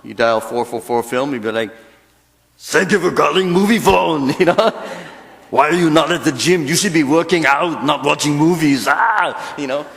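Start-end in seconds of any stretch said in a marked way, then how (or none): 2.6-4.4 put-on louder Austrian-accented action hero; Arnold Schwarzenegger. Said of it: "Arnold Schwarzenegger to do it". 5.5-9.6 put-on louder Austrian-accented action hero; Arnold Schwarzenegger. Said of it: "Arnold Schwarzenegger to do it".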